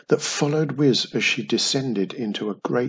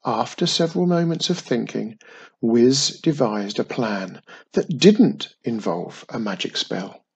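plain reading voice

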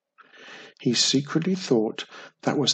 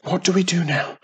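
whisper; 5 semitones higher, 3 dB louder, faster than usual. A narrator's reading. Still whispering to cousin.

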